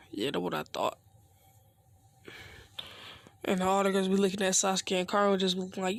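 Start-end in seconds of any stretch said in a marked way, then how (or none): 0.1-1.0 cool smug taunt. Acted. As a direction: lower and softer, unbothered swagger. Sasuke taunting after Naruto backs off.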